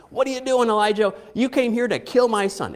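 high, loud accusing cry; grieving mother lashing out at Elijah, loud and high; upset accusing. Retold or imitated. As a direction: hurl the blame at Elijah. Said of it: "You just get upset".

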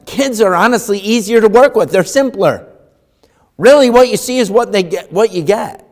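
high, loud preacher's build; preaching. Acted. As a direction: drive the point home from the pulpit.

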